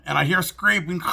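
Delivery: normal talking voice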